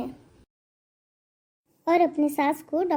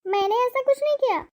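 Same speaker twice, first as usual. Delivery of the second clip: much higher, fast protest; shrill denial; 9 semitones higher, faster than usual. Acted. Wife protests her innocence to her husband, much higher and fast. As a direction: deny it fast and high, flustered. Priya denies accusation to husband.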